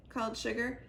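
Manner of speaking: ordinary delivery, nothing marked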